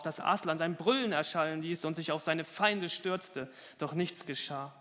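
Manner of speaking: usual speaking voice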